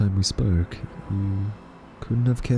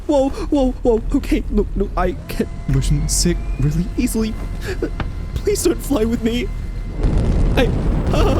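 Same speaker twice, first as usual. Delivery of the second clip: higher, louder nervous babble; panicked; 13 semitones higher, 4 dB louder than usual. Acted. Captive snatched up by the robot, panicked pleading. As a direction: blurt it out, scared of flying.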